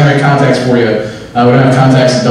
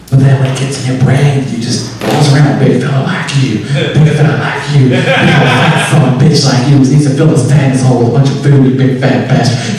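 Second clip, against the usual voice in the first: put-on southern accent. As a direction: insult them nicely, southern style. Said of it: "say it in a southern accent".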